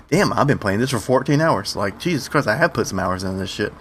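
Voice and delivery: usual speaking voice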